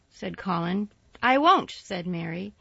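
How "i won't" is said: louder, higher, defiant no; flat refusal. A narrator's reading. Mary refusing Colin, loud and defiant. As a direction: bite off the refusal.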